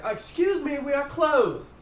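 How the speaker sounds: high, loud call through the door; halflings calling out. Acted. Halflings shouting to constables outside, high and loud. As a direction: shout that the place is closed. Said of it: "the halflings say".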